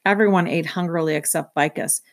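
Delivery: ordinary delivery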